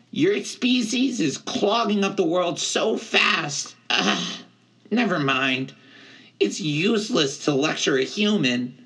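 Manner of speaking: high, indignant satyr voice; high satyr rant sinking into frustration; Grover, exasperated. A narrator's reading. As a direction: scold in a high-pitched character voice. Grover lectures his friend.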